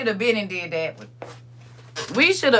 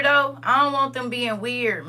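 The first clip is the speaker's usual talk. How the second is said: ordinary talk, nothing marked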